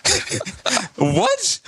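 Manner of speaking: high, laughing mock disbelief; incredulous. Contractor looks around for cameras, high mock incredulous. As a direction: squeak it upward through a laugh.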